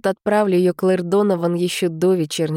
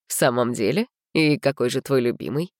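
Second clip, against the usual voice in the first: low teasing male voice; 6 semitones lower than usual. Acted. William voiced.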